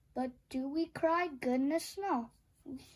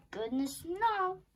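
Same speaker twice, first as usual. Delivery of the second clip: ordinary delivery, nothing marked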